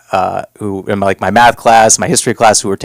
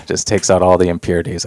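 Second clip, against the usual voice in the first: normal talking voice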